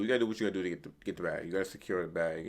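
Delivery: normal talking voice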